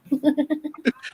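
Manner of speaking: high-pitched giggle; short burst of high laughter. Real, not acted. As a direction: giggle at your own joke. Laughing at own joke.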